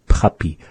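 puppy with both p sounds puffed hard; exaggerated p. Shown as a demonstration. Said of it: "really exaggerate those".

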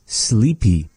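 ordinary delivery

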